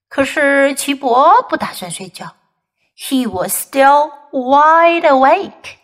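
usual speaking voice

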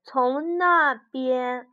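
slow, deliberate little bear. Acted. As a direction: speak slowly like a small bear. Bear speaks in story.

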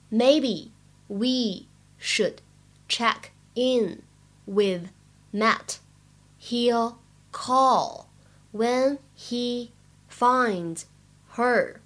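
very slow reading of "Maybe we should check in with Matt", a pause between words. Shown as a demonstration. Teacher modelling the dialogue for learners, slow word by word. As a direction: spell out the sentence one word at a time, slowly.

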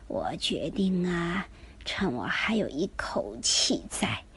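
faint, slow dying whisper; barely audible. A narrator's reading. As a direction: speak on your last thread of breath. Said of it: "气若游丝地说".

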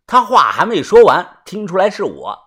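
plain reading voice

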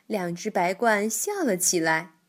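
usual speaking voice

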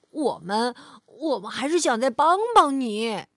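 ordinary delivery, nothing marked

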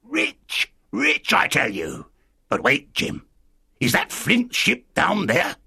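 high, slow castaway voice; strange castaway. Acted. Castaway insisting to the boy he is rich, strange slow voice. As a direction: insist in a drawn-out, odd voice.

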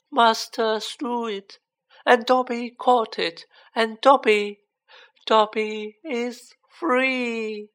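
high, very slow elf voice; high, halting wonder, stretching the final word; amazed elf. A narrator's reading. Dobby realising he is free, in slow amazement.

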